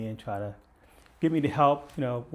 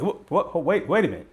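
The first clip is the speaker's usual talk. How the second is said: startled; 6 semitones higher, 6 dB louder than usual. Retold or imitated. Officer startled by the death threat.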